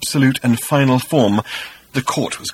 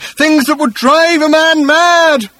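slow, very high full-voiced shout; frantic yell, drawn out to the end; 8 dB louder, slower than usual. A narrator's reading. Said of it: "he shouted".

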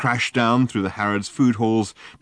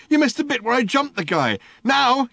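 high, loud outraged complaint; furious he missed it; raging; 3 dB louder than usual. A narrator's reading. Ford raging on.

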